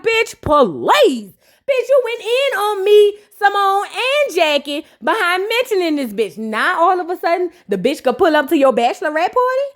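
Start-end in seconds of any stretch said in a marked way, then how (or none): 0.0-6.4 imitating Toya, higher. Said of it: "And Toya was like".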